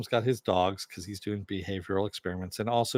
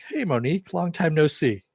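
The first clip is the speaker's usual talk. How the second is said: loud, higher, breezy mock greeting as if nothing happened; cheeky greeting; 7 semitones higher, 6 dB louder than usual. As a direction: toss it off like an old pal caught red-handed. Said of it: "and he's like".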